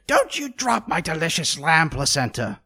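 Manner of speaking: harsh, menacing whisper imitating an angry wife; cross whisper. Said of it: "Maris got very cross with me".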